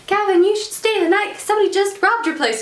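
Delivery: high-pitched mom voice; high, quick acted mom; mom's invitation. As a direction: voice an insistent mother, pitched well up. Mom voiced, inviting Gavin over.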